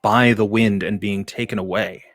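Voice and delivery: loud, carrying narration over music; scene narration. Acted. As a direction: set the scene with sweep. Game master's scene setting.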